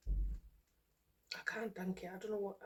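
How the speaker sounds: very slow, choked-up whisper. Real, not acted. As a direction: gratitude too big to voice, fading to breath. Thanking viewers, overcome.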